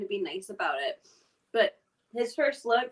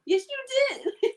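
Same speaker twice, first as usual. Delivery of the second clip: high, slow teasing insistence; insistent; 14 semitones higher, 5 dB louder, much slower than usual. Real, not acted. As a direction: drag it out teasingly. Playful argument over drink.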